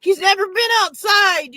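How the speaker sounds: high, loud panicked cry; frantic owner insisting to roommate; panicked insistence. Retold or imitated. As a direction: plead it, voice up and loud.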